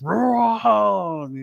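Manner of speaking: high, drawn-out imitated roar; long rising mock roar; loud roar. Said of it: "just loud, loud roar".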